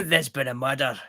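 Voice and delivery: laughing mock Scottish; a laughing go at a Scottish detective line; Scottish accent, giggling through it. Shown as a demonstration. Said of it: "now I'm trying to do a Scottish one".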